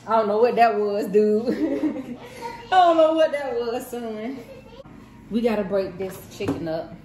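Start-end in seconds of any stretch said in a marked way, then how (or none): 0.1-4.4 loud, amused disbelief breaking into laughter; laughing. Real, not acted. Parent reacts to child's odd attempt, laughing. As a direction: crack up loudly between the words.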